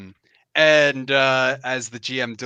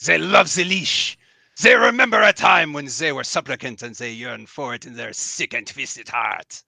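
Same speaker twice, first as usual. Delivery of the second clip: put-on French-accented knight denouncing the enemy; grim declamation in a French-tinged accent; bitter French-lilted accent, slowing on the last words. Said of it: "a little bit of a French lilt".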